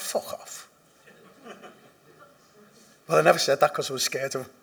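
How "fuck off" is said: under-breath fuck off; muttered retort. Retold or imitated. The speaker silently cursing his sponsor, under his breath. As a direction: say it like a thought in your head. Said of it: "I went".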